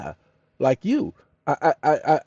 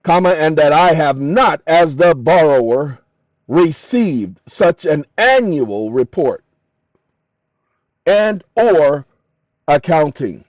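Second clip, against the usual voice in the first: dictating; 2 semitones higher, 9 dB louder, slower than usual. Real, not acted. Dictating a legal prompt.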